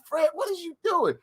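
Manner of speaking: high incredulous put-on voice; baffled question. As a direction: squeak out disbelief. Self voiced confused.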